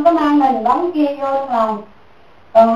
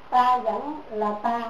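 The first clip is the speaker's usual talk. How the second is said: softer, slow, each word set down; stubborn motto; 10 dB quieter, slower than usual. Retold or imitated. Nun quoting her stubborn reply, soft and slow. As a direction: quietly dig in your heels. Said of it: "Ngang ngược rồi đó".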